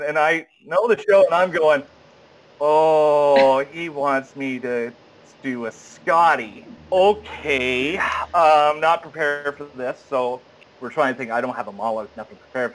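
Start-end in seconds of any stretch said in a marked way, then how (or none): 2.6-10.0 slow, drawn-out thinking aloud; dawning realization. Retold or imitated. Unprepared actor works out what director wants, dawning realization. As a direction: let it sink in word by word. Realizing unexpected request at audition.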